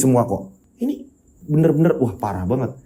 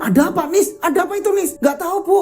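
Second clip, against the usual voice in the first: much higher, frantic questions; panicked; 12 semitones higher, faster than usual. Acted. Mother and daughter panic as an ambulance arrives. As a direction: ask in rising alarm.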